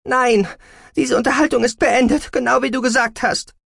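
very high, loud retort; furious daughter refusing to continue; defiant fury. A narrator's reading. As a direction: shout it back at mother. Daughter throws words back angrily.

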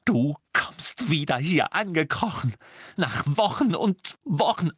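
seething, indignant rise in pitch; furious reproach. A narrator's reading. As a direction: fling the accusation at him. Hermine rages at returned Ron.

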